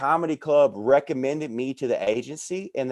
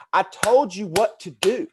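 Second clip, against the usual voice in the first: higher and slower, fed up; slow, higher told-you-so; exasperated; 6 semitones higher, slower than usual. Retold or imitated. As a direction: spell it out slowly like you've said it a hundred times. Retelling telling people off.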